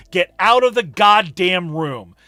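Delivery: usual speaking voice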